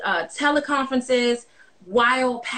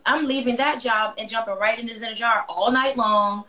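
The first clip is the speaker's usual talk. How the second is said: normal talking voice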